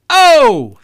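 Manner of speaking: loud, high comic's catchphrase cry; Andrew Dice Clay. Acted. Comedian quoting another comic's catchphrase, loud impression. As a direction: belt out a brash comic's signature exclamation. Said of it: "If you're Andrew Dice Clay".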